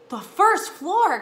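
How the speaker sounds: slow, high, loud astonishment; incredulous. A narrator's reading. Boy hearing where the mouse went, incredulous. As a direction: stretch it out in loud disbelief. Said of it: "Keith could not believe it".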